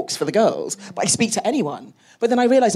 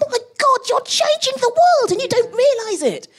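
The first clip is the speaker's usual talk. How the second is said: much higher, near-tearful gush of amazement; excited. Retold or imitated. Mentor gushes to a modest female scientist, high and excited. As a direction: squeal it like you can't believe they don't see it. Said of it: "And I'm like".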